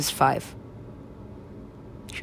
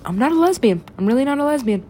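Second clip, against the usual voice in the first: protesting, pitched high; high-voiced denial; insistent protest; 8 semitones higher, 4 dB louder, faster than usual.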